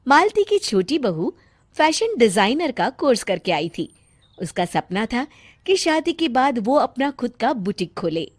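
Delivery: ordinary delivery, nothing marked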